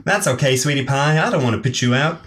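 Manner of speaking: high-pitched sugary southern belle; southern accent. Acted. Alicia declines Nicole's invitation in a southern accent. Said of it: "I love the accent".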